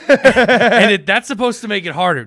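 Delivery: laughing through the words; giggling while talking. Real, not acted. Laughing at own story.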